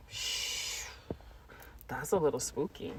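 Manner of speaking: hush. Real, not acted. Hushing herself mid-thought.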